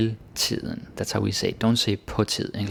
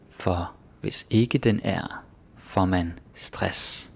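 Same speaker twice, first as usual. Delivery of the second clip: slow, careful Danish reading; slow example read; slower than usual. Shown as a demonstration. Teacher reading the Danish sentence slowly for learners. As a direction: spell out each word slowly.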